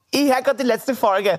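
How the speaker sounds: excited fan. Retold or imitated. Fan telling podcaster she is listening, high-pitched. Retold shy fan speaking to host.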